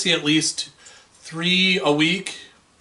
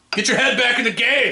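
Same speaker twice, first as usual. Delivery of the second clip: mock pep shout; 6 semitones higher than usual. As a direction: call your teammate back to focus. Mock pep call to co-host.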